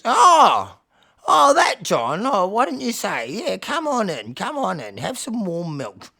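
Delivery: loud, high, welcoming elder; slow singsong welcome, suddenly remembering; old cat leader. Acted. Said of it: "And the cat leader says".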